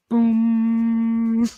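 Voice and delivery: long droning "boom" like a struck gong; imitated clang. Reader mimicking struck cymbals, droning boom. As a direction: hold the boom out on one note. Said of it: "it makes a clanging sound like cymbals".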